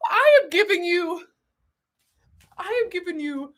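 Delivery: hint of a shaky-attempt Russian accent; mock Russian grandma. Modelling a scarf as a babushka, mock Russian accent. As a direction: lightly put on a Russian granny accent. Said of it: "Russian grandma".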